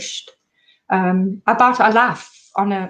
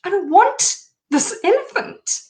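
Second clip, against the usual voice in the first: high-pitched, louder, pleading refusal; a retold distraught cry of refusal; desperate protest; 11 semitones higher, 4 dB louder than usual. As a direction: push the gift away in distress. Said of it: "And I was like".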